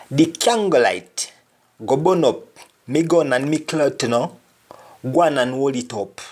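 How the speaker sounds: spoken in broad Jamaican patois. Shown as a demonstration. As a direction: read the line as a Jamaican patois speaker. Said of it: "For example".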